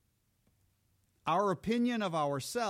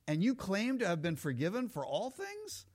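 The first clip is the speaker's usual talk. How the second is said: usual speaking voice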